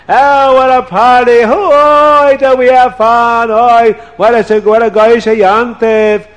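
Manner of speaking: high, loud, giddy celebrating; merry revellers singing out; party cheer. Acted. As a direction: whoop like coming home from a party. Household returns from party.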